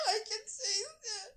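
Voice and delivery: high, slow, squeaky-laugh repeat; helpless laughter. Real, not acted. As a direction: repeat it while breaking up laughing. Repeats screamed quote while laughing helplessly.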